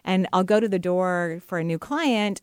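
ordinary delivery

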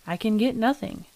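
ordinary delivery

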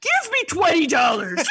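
laughing shouted doll voice; mock doll demand. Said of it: "that voice".

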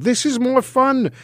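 high playful Herschel. Acted. Herschel to the goblin, high and playful. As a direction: brush off the goblin lightly.

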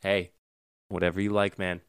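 usual speaking voice

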